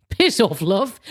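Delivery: slow, laughing brush-off; mock dismissal. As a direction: wave it away with a laugh. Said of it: "you just go".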